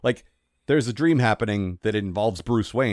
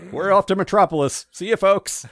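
high, loud mock farewell to a crowd; cheery showman send-off; 8 semitones higher, 5 dB louder than usual. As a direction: call out a hammed-up circus goodbye. Plays Flying Graysons leaving town.

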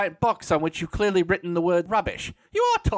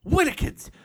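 gasp; 8 semitones higher than usual. Acted. Finds a treasured item.

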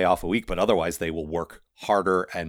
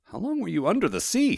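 much higher character voice; high-pitched questioning of a marvel; curious questioner; 11 semitones higher than usual. A narrator's reading. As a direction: ask in a high, amazed voice. Moist questions the golem.

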